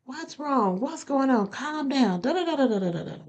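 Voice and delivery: mildly imitating a worried mother; worried mom. Retelling her mom's call, a mild worried-mother imitation. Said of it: "she was like".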